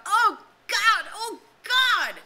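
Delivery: high, loud desperate outcry; anguished cry. A narrator's reading. Ralph agonized on the mountain, desperate high cry. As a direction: cry out torn by indecision. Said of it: "Ralph cried out".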